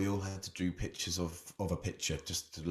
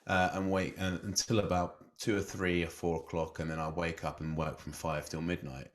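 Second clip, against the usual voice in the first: usual speaking voice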